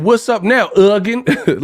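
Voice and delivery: high, challenging gangster call; mock gangster. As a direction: parody a movie gangster's greeting. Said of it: "He said".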